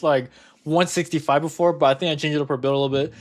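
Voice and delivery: ordinary talk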